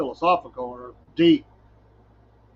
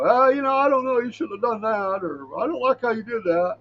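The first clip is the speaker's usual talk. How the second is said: judgmental critic; 5 semitones higher than usual. Retold or imitated. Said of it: "come out and go".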